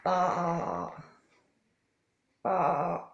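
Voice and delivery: mock sheep baa; sheep bleat. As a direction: bleat it for the children. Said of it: "What noise does a sheep make?".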